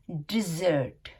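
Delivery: डिजर्ट as "di-ZERT"; short i, then buzz the z in डिजर्ट; z sound in the middle. Shown as a demonstration. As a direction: model डिजर्ट with weight on "zert". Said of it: "तो कैसी आवाज़ निकलती है".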